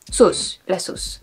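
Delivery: "sauce" the French way, with a long O; French pronunciation. Shown as a demonstration. French speaker showing the original word, French pronunciation. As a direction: slowly say "sauce" as French "sohs". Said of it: "I think it's a French word".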